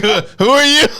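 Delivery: high, loud imitation of a baffled guest; mock non-recognition. Host imagines guest not knowing him, loud mock bafflement. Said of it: "If he acts like he doesn't know me".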